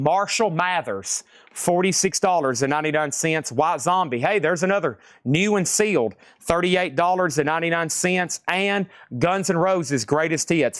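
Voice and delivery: usual speaking voice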